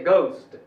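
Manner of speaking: घोस्ट as "goust", unaspirated; घोस्ट with hard g and gliding o; correct English ghost. Shown as a demonstration. Teacher repeats the right form, घोस्ट as "goust". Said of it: "तो इसको बोलना चाहिए".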